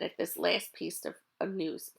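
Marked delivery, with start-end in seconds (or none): none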